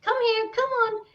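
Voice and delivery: high-pitched coaxing call; beckoning animals, pitched right up; calling the dogs. Retold or imitated. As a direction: coax dogs over in a high voice. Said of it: "I'm calling out for the dogs".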